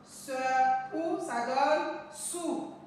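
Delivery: teacher's drawn-out blend of "Se" and "ou" into "sou"; syllable blending. Shown as a demonstration. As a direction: slowly sound out "Se", "ou", then "sou". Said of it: "Nous allons lire nos syllabes formées". (assembler lentement « Se » et « ou » en « sou »)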